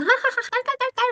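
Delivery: high, hammed-up cackling laugh at a comedy show; mock laughter. Teen on the sofa watching comedy, hammed-up high laugh. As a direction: laugh like a kid glued to the TV. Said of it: "막 이렇게 한 거죠".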